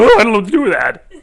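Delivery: loud, fast, laughing bewilderment; laughing fluster. Retold or imitated. Teacher mocking a flustered, overwhelmed reaction, loud and laughing. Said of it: "You don't go".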